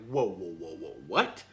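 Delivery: mock-stunned, dropping the voice low then what rises; stunned disbelief. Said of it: "I was like".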